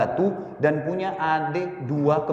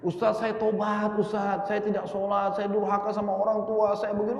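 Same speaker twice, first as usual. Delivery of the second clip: higher, tearful-sounding confession retold; mild trembling plea; 5 semitones higher than usual. Man fresh from the grave confessing to the preacher, trembling and tearful. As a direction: voice the shaking man begging forgiveness.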